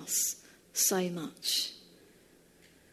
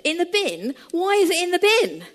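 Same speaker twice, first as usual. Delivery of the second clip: higher and louder, incredulous; rising outrage, can't believe it; shocked disbelief; 9 semitones higher, 6 dB louder than usual. Retold or imitated. Liz demanding of her fiancé why, incredulous.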